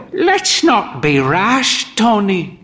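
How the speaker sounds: put-on high, slow frail old lady; slowed, lifted voice of the old woman; old mother voice. A narrator's reading. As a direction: pitch her up like a proud elderly mother.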